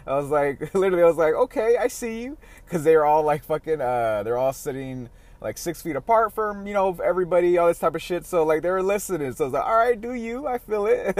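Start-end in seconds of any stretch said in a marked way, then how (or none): 1.3-2.4 high, amused nod to the crowd; teasing approval. Retold or imitated. Spotting the crowd sitting apart in the grass, playful high teasing. Seeing people gathered in the park. 9.7-11.2 high, mock-encouraging shrug; amused approval. Letting the park crowd do their thing, high amused approval.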